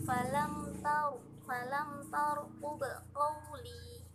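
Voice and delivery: slow chant with a thick, heavy r in "tarqub"; weight the r of "tarqub" with a full, dark sound. Shown as a demonstration. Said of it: "yang diberatkan membacanya". (huruf r pada "tarqub" dibaca berat dan tebal)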